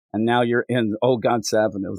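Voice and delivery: ordinary talk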